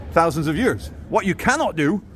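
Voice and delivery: ordinary talk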